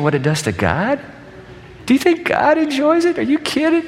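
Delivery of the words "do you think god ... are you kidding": incredulous. Real, not acted. Rhetorical incredulous question.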